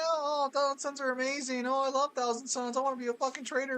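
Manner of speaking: hammed-up adoring fan parody; mocking imitation. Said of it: "talking all that good shit about the Thousand Suns".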